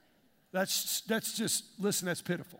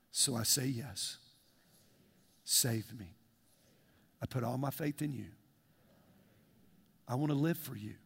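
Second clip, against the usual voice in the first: guided prayer; 7 semitones lower, 5 dB quieter than usual. Acted. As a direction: lead the congregation humbly through the prayer. Leads a confessional prayer.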